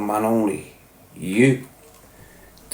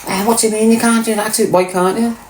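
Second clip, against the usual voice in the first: mock parent challenge; 6 semitones higher than usual.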